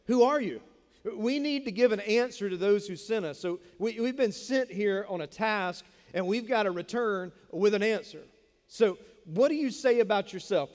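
loud, pressing demand for an answer; loud demanding priests. Retold or imitated. Priests from Jerusalem pressing John, loud and insistent. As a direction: demand it like an impatient envoy who must report back.

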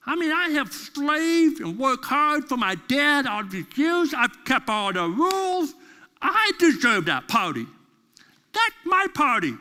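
high, indignant sulky protest; aggrieved brother who feels cheated; pity party whine. Retold or imitated. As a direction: whine like a slighted older son. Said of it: "begins to have a pity party".

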